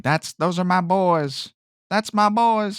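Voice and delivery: a hint of a proud old dad; Mario's dad voice. Podcaster quotes Mario's dad hugging his sons, lightly voiced. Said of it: "he says something like".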